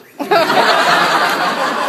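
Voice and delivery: high, loud breathy laughing fit; laughing so hard it goes up high. Real, not acted. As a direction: shriek with laughter. Laughing after "I peed a little.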